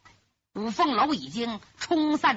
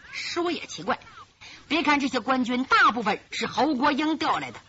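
ordinary delivery, nothing marked

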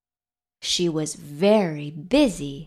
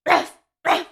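high, short imitated barks; dog bark; 9 semitones higher, 3 dB louder than usual. A narrator's reading. Dog greets the spider with high short barks. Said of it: "barked the dog".

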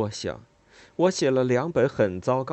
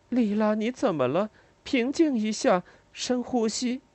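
uneasy; 11 semitones higher than usual. A narrator's reading. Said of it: "我很不安地说".

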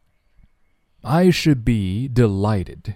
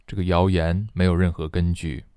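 ordinary talk, nothing marked

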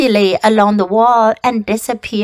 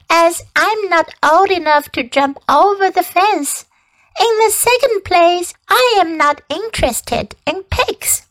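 haughty lamb voice; 8 semitones higher than usual. Acted.

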